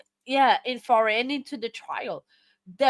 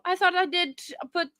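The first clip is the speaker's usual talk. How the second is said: normal talking voice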